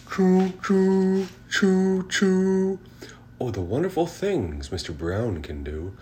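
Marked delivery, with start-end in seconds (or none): 0.1-2.8 high loud train whistle; train choo choo. A narrator's reading. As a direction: chug like a steam train. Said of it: "He can go like a train".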